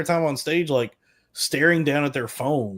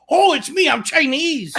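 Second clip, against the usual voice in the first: high, loud mock Chinese accent; hammed-up Chinese accent impression; 14 semitones higher, 6 dB louder than usual. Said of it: "here's my impression of Chinese".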